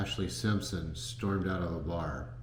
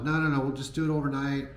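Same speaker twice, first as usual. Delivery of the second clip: higher, louder, fast breezy friend voice; friend brushing it off; 7 semitones higher, 4 dB louder, faster than usual. Retold or imitated. Friend Corby brushing off the travel plan, breezy. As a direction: wave off the worry, quick and light. Friend answering his protest.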